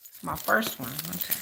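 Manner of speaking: ordinary delivery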